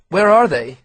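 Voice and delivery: loud, insistent demand. A narrator's reading. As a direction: demand it sharply, a scared boy pushing back. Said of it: "he demanded".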